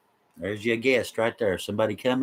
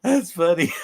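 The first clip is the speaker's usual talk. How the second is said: laughing; 7 semitones higher, 5 dB louder than usual. Real, not acted. Laughing fit on a livestream.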